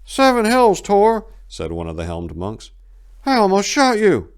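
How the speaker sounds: high, loud voice of a startled archer; shaken monk. A narrator's reading. Helmed monk greeting Tor.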